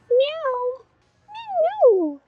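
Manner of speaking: very high meowing at a cat; squeaky kitten meows. Pretend. Imitating to shop cat.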